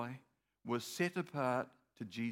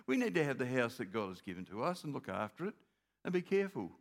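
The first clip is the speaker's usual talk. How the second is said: normal talking voice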